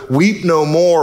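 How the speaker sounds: ordinary talk, nothing marked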